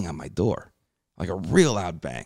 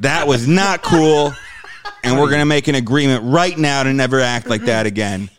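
mock stern; 5 semitones higher, 7 dB louder than usual. Laying down a new rule to his ex, mock stern and loud. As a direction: lay down the law in mock earnest. Scolding ex after making out.

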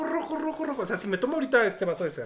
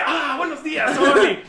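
cheery morning greeting; 8 semitones higher, 7 dB louder, slower than usual. Retold or imitated. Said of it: "abro las cortinas así de".

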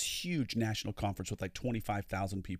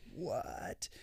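stunned unvoiced whisper, much softer; shocked whisper; 8 dB quieter than usual. Organized lady hearing no rooms booked, whispered shock. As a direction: breathe it out in disbelief. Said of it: "And so then she's like".

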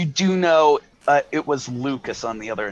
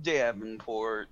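slow, low, soft, crestfallen; deflated; 4 semitones lower, 8 dB quieter, slower than usual. A narrator's reading. Davenport after being yelled at, deflated. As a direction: say the name as if all bounce is gone. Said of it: "And he's just like".

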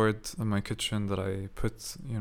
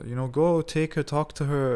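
higher-pitched egging-on, imitated; retold urging; 5 semitones higher, 3 dB louder than usual. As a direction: lightly mimic a friend pushing someone along. Retells friend urging him on.

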